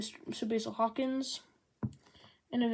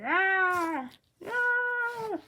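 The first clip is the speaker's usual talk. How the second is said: two loud rising cartoon yelps, the second much higher; mock screams; 10 semitones higher, 6 dB louder than usual. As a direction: yelp like a panicked cartoon rider. Character yelling while riding off.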